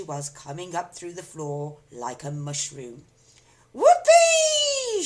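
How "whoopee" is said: shrill, very high, loud whoop with a laugh; joyful shout. A narrator's reading. Grandma bursting through the floor, gleeful whoop. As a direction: whoop like a wild old crone. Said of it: "she shouted".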